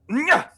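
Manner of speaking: high loud spurring cry; rider's yell. Acted. Jim spurs the witch into a gallop. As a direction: yell to spur the mount on.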